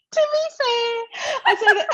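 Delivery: "To be fair" high and laughing through it. Shown as a demonstration. Amused at her own habit, laughing "To be fair". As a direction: laugh while you say "To be fair". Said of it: "I start saying things like".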